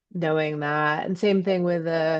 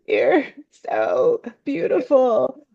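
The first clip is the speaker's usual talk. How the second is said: high, slow, weepy imitation; hammed-up sobbing admiration; mock tearful crying; 8 semitones higher, 5 dB louder, slower than usual. Speaker crying to a bluebird after a breakup, tearful. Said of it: "and I'm crying, I'm like".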